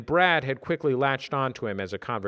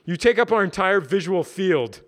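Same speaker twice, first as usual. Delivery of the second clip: loud, raised mocking voice; 8 semitones higher, 6 dB louder than usual. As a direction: tease him loudly.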